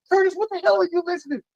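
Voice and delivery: high-pitched, fast mock questioning; imitating incredulous coworkers. Acts out coworkers questioning him.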